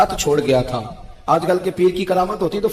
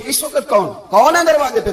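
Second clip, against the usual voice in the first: higher, loud alarmed call at the door; startled call; 8 semitones higher, 6 dB louder than usual. Retold or imitated. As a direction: wake in fright and shout who is there. گھبرا کے اٹھے.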